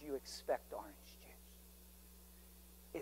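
slow, soft whisper. Retold or imitated. Preacher voicing the Lord in a hushed whisper. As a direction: let the words fall to a breath.

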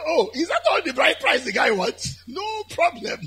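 high, laughing boast as David; amused bravado. Acted. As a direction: shrug off the price with a high chuckle. David scoffing at the bride price.

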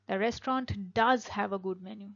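"does" stressed, slow delivery. Shown as a demonstration. Teacher showing an emphatic reply about the menu. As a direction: assert it with "does". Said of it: "খুব জোর দিয়ে বলছো".

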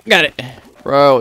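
ordinary talk, nothing marked

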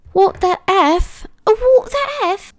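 very high, drawn-out disbelief; shocked disbelief. Real, not acted. Reacts to game shock.

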